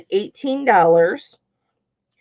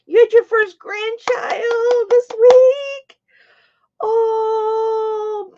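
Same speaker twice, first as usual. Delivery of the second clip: high, drawn-out crying voice; overcome, stretching the words high; emotional, tearful; 13 semitones higher, much slower than usual. Real, not acted. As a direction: wail it slowly through tears. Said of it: "This is why I'm so emotional".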